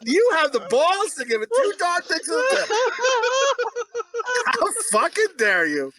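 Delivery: half-crying, hammed-up indignant shout; mock outrage. Host scolding cohost for his rating, hammed-up tearful shout. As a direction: fake being wounded on the film's behalf.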